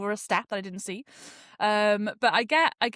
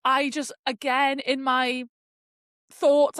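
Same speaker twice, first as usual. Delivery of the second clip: ordinary talk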